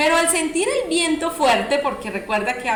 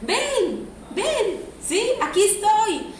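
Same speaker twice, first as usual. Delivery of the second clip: put-on higher, sing-song call to a baby; mother calling baby; 7 semitones higher, slower than usual. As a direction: coax the baby into the pool. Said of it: "la mamá le decía".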